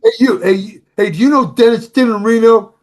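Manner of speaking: put-on loud, high, brash New York accent. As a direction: call out like a tough New York beauty queen. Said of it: "she has a big New York accent".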